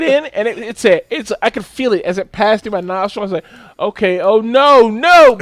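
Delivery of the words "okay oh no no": slow start building to a high, loud "no"; rising mock panic. Patient retelling the swab going in, mock panic. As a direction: protest louder as it goes in too far.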